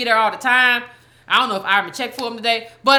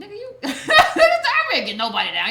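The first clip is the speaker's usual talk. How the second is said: laughing; 8 semitones higher than usual. Real, not acted. Laughing at missing usernames.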